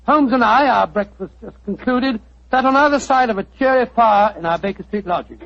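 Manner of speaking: measured storyteller setting a foggy scene; story narration. A narrator's reading. Watson telling Mr Bartell his story, steady narration.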